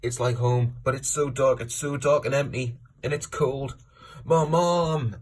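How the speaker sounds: high, loud mock cries for mother; imitated desperate shout. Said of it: "Will shouts".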